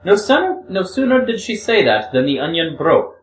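a hint of a young woman, earnest and pleading; lightly voiced Grushenka. Reader voices Grushenka confessing.

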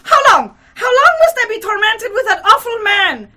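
high, very loud frenzied outburst; furious near-shriek. A narrator's reading. Hostess interrupting her visitor, shrieking in fury. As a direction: cut in furiously, almost shrieking. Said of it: "all but shrieked and furiously interrupted".

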